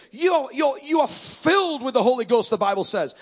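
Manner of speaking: ordinary delivery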